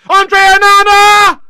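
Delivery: high, loud, drawn-out name call; urgent shout. Real, not acted. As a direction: roar the keeper's name, stretching it out. Penalty struck, shouts keeper's name.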